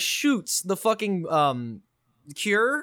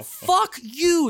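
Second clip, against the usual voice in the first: higher, a half-played curse at Nikolai; mock angry curse; 10 semitones higher, 4 dB louder than usual. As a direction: spit it at the villain, half in jest. Cursing Nikolai in retold anger.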